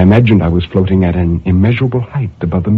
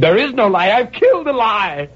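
scream; 11 semitones higher than usual. Acted. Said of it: "stamped on the floor and screamed".

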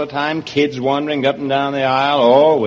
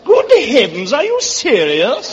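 higher, hammed-up English gentleman; English accent; 3 semitones higher than usual. Comic is aghast at host's question, English accent. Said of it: "that's a wonderful English accent".

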